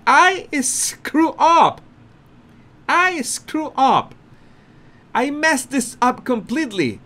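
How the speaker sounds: imitating Harry realising he blew it; hammed-up flustered guest; mock panic.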